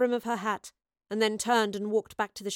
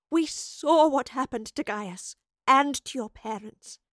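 raised, earnest plea; pleading; 5 semitones higher, 4 dB louder than usual. A narrator's reading. Professor explaining to Astrid why she can't help, pleading. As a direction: plead with rising urgency and stressed words. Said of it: "she pleaded".

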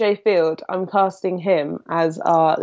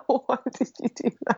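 laughing; 4 semitones higher, 7 dB quieter than usual. Real, not acted. Both laughing at eating noise.

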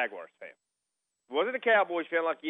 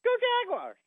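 put-on much higher, piping kid chant; kid cheering. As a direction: chant it like an excited six-year-old. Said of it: "He goes".

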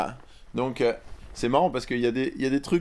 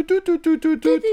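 phone dialing beeps; 7 dB louder than usual. Pretend. Imitating phone dialing tones.

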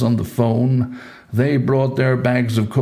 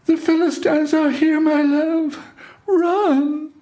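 very high, held falsetto wail of warning; Delilah's false alarm. A narrator's reading. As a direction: cry out the alarm as Delilah, far above your own voice. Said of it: "raised her voice with wails and tears".

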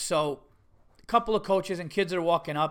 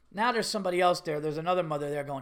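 usual speaking voice

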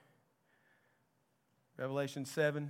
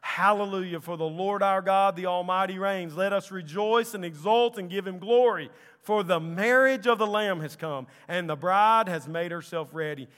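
loud, raised shout of rejoicing; crying out; 5 semitones higher, 10 dB louder than usual. Acted. Great multitude crying out praise, loud and jubilant. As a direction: proclaim it jubilantly with sustained force. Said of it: "crying out".

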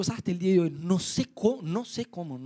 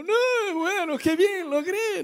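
much higher, torn cheer; rueful mock cheer; 11 semitones higher, much slower than usual. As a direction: cheer while half regretting. Mixed feelings inner voice. (alegría forzada, mucho más aguda)

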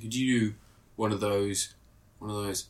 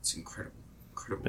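breathy awed whisper; 4 semitones lower, 11 dB quieter, faster than usual. Real, not acted. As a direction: hush it in awe. Awed whisper about the film.

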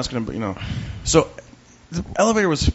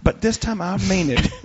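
earnest clerk; 5 semitones higher than usual. Retold or imitated. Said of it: "But you guaranteed me last time".

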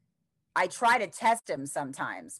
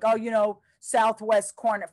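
mock know-it-all; 5 semitones higher, 6 dB louder than usual. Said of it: "People would be like".

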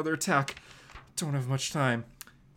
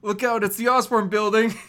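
higher, louder playful warning; playful shout; 7 semitones higher, 7 dB louder than usual. Acted. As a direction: call it out cheekily.

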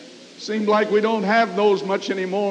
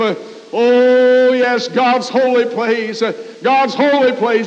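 raised, driving church preacher's delivery; fervent preaching; 3 semitones higher, 5 dB louder than usual. Real, not acted. Preacher urging the congregation toward God's holy place, fervent preaching. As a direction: preach it out with rising fervor, drawing out the cries.

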